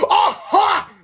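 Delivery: high, loud gotcha yell; mean principal catching culprits. A narrator's reading. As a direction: pounce on them with a triumphant shout. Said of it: "yelled Mister Krupp".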